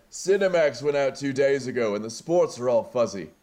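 put-on drunk Thor voice. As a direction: call out like a drunk couch potato from the next room. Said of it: "And it's Thor".

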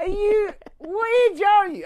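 high, loud, slow incredulous squawk; hammed-up outrage at a grown man; mock disbelief. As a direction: drag out the shocked question. Teasing friend about James Bond.

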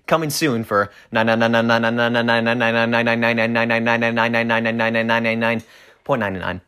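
ad announcer. Acted. Mock ad announcer price.

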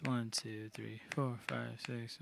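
soft, evenly spaced count in six eight; counting the beat. Shown as a demonstration. Demonstrating the song's six eight time, soft rhythmic counting. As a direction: count out the beat under your breath. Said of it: "songs in six eight".

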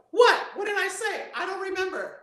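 high, sudden startled what; startled forgetful yellow. Retold or imitated. Creative coworker asked to repeat an idea, high and startled. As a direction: blank surprise, can't recall a thing. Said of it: "they'll go".

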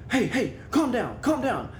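high-pitched urgent mock scolding; acted urgent calming. Man to his barking dog, acted urgent calming. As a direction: imitate an owner trying to settle a frantic dog.

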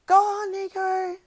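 stretched, high-pitched barracking call; mock crowd cheer. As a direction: imitate a rowdy fan yelling encouragement. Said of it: "then people are like".

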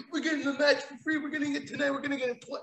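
high, soft, fast giddy mimicry; hammed-up excited fan; mocking fanboy. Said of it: "mocking fans on Twitter".

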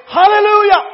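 high loud hallelujah; joyful shout. Shown as a demonstration. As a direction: belt it out after eating. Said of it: "everything and say".

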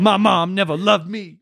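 high, slow mock-rapper lament; hammed-up aggrieved rapper tirade. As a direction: complain about childhood in a mock rap voice. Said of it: "Then he goes on a twenty-minute tirade about his mom".

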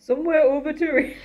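attempted Northern accent. Shown as a demonstration. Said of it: "getting into a British Northern accent".

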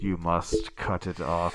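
serial killer voice. Acted. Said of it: "I will not do that voice again".

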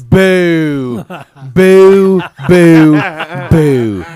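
loud playful boos; mock booing. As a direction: boo him like a crowd. Booing a bad joke.

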